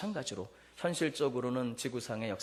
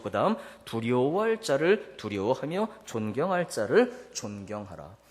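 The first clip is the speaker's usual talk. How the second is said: scripture reading; 5 dB louder than usual. Shown as a demonstration. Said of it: "오늘 본문 칠 절 다시 한번 봅시다".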